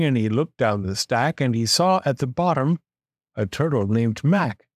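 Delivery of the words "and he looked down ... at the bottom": plain reading voice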